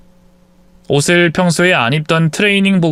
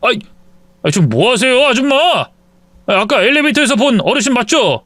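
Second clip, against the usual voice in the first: startled protest; 8 semitones higher than usual. Acted. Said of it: "기싸대기를 후려 갈겼습니다".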